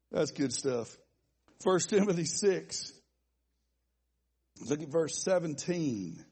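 ordinary delivery

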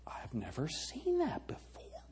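breathless whisper of disbelief; stunned whisper. Acted. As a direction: whisper it, floored. Stunned religious leader whispers.

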